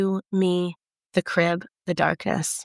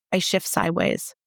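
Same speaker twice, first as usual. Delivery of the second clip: ordinary delivery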